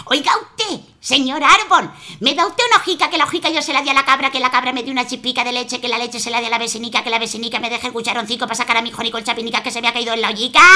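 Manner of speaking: mother listing everything to the tree; rapid rattle. Acted. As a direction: rattle off the whole chain breathlessly.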